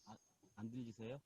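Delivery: barely audible phone line; faint connection. Real, not acted. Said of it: "소리가 너무 적어요". (멀리서 작게 들리는 통화 소리)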